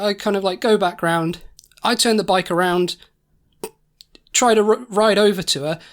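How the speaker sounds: normal talking voice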